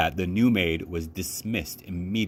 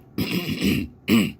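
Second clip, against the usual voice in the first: clears her throat before the tale; a small cough to begin; throat clearing; 3 dB louder than usual. A narrator's reading. Grandmother clears her throat before the story. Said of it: "She coughed".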